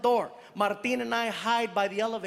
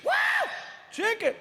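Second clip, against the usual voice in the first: high, thrilled cry; delighted at the gift; high excited exclamation; excited yelp; faster than usual. Retold or imitated. Excited man unpacking basket.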